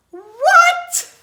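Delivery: very high, loud squeal of disbelief; loud, high storybook shock; shocked cry. Acted. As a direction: shriek it in mock astonishment. Shock at monkeys wearing caps.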